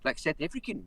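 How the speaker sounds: normal talking voice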